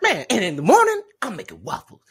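high, loud, excitable donkey voice; hammed-up comic sidekick impression; Eddie Murphy. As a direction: burst out high, then drop on the last words. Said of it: "He's like".

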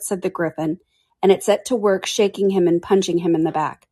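ordinary delivery

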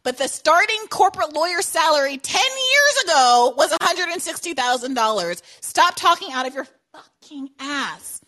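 loud, high furious rant; angry rant. Real, not acted. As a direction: tear into her claims. Ranting at guest's claim.

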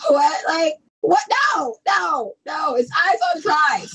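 high, incredulous mock protest; playful squeal of disbelief; mock indignation. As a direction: object in a high, exaggerated voice. Acting out shock at the shortening.

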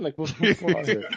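laughing disbelief. Real, not acted.

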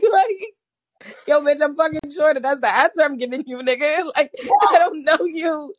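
high laughing retelling. Real, not acted. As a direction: laugh through the retold reply.

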